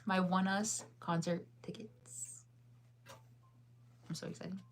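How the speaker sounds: hushed, thrilled whisper; excited whisper. Real, not acted. Excited whisper about concert tickets.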